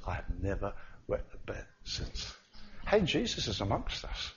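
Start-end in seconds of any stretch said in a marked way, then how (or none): 0.1-2.3 soft, whispered report; quiet. Retold or imitated. Student telling the preacher weeks later, whispered. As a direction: hush it out, shy but glad.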